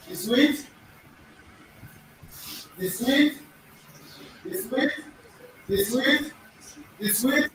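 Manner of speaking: The sweet said over and over, rising at the end; repeated model pronunciation. Shown as a demonstration. Teacher drilling the class on the sweet, rising. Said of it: "Can somebody pronounce attempt?".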